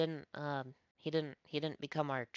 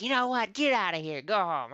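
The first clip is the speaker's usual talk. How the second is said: the coach yelling higher and louder, throwing him out; angry yell; 6 semitones higher, 8 dB louder than usual. Retold or imitated. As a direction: bark get out and go home, high and loud.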